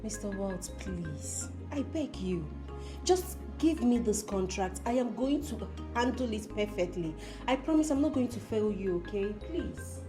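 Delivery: soft, slow, hushed plea; earnest begging. Acted. Petitioner begging Mister Walt for a contract, earnest begging. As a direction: plead quietly, drawing the words out.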